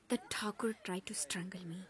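hushed whisper. Real, not acted. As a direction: whisper the secret. Whispered confession.

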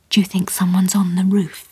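nervous whisper up at the ceiling. Acted. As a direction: breathe it, scared of the noise. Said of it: "whispered Brenda".

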